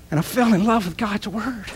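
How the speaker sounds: holding back tears on God's Word; tearful, emotional confession; choked up. Real, not acted. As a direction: let the voice catch with real tears. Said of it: "I'm sorry for crying".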